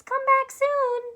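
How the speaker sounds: high, slow, loud cheerful farewell; high sweet call of parting friends; unicorns calling. Acted. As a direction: sing it out like friendly unicorns waving goodbye. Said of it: "what the unicorns are saying. It's".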